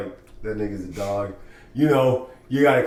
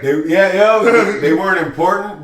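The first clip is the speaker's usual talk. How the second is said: higher, louder eager talk; loud excited; 8 semitones higher, 10 dB louder than usual. Real, not acted. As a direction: push it out excitedly.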